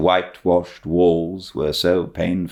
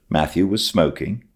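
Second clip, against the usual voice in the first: ordinary delivery, nothing marked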